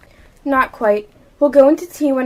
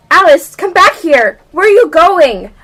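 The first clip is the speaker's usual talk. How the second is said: put-on louder, calling after a runaway child; 8 dB louder than usual. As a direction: call her back across the garden. Sister calling after Alice.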